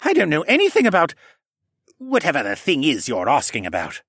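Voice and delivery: high, protesting start that eases down; feigned innocence. A narrator's reading. Assassin denies knowledge to his interrogator, feigned innocence. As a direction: deny everything with false innocence. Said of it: "he asked with feigned innocence".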